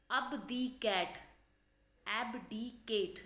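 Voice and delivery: abdicate stretched out very slowly; slow spelled-out word. Shown as a demonstration. Teacher to students, saying abdicate very slowly. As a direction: draw out abdicate syllable by syllable. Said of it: "ये word बना है abdicate से".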